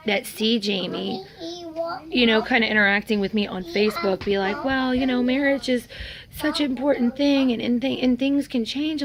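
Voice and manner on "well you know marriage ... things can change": higher-pitched imitation of naive advice; mock well-meaning friend. Voicing naive friends on Facebook.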